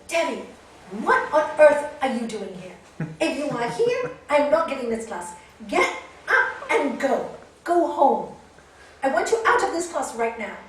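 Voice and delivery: loud angry scolding; shouting teacher. Retold or imitated. Teacher scolding Debbie to go home, loud and angry. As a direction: order her out at full volume. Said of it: "by shouting at her".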